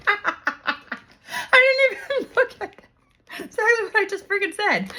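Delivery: real laughter breaking through high, delighted words; high, giggly disbelief at the cards; laughing. Real, not acted. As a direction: laugh it out, thrilled the card matches. Laughing at the card she drew.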